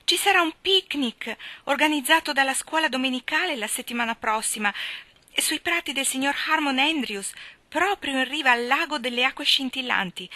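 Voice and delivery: Anna thrilled about the picnic; excited girl. A narrator's reading. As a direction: spill the news all at once. Anna bursting with picnic news.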